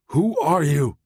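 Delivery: high strained shout; strained yell. A narrator's reading. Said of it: "he yelled in a strained voice".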